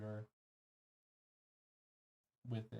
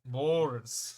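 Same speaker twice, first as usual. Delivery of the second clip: calling out; 2 semitones higher, 10 dB louder than usual. Real, not acted. Calling out his name.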